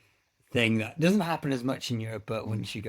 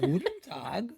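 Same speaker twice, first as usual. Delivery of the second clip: high, exaggerated German greeting; German accent demo; 8 semitones higher, 3 dB quieter, slower than usual. Shown as a demonstration. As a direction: demonstrate a playful German accent. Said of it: "You gotta start".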